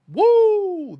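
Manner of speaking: a very high, loud cheer; victory whoop. Real, not acted. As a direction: whoop for the win.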